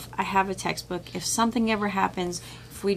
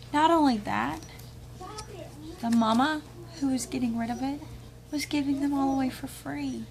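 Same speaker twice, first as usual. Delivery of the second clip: normal talking voice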